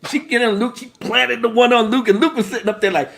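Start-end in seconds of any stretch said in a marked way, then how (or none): none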